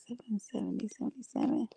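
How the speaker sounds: hushed whisper, barely voiced. Real, not acted. Reading a viewer comment softly to herself, whispered. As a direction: breathe the numbers out.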